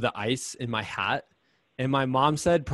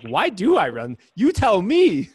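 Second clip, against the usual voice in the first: half-laughing, rising to a loud, higher "you tell me"; exasperated self-talk; 7 semitones higher, 6 dB louder than usual. Retold or imitated. Runner venting at herself mid-race, mock exasperated. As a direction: throw the question back, fed up.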